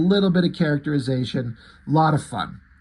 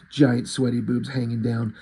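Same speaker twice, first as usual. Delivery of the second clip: usual speaking voice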